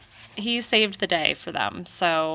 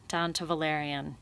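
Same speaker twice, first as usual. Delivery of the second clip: ordinary delivery, nothing marked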